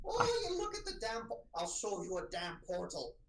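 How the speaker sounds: high, offended librarian retort; a proud scholar insulted; affronted Diana. Acted. GM voices Diana.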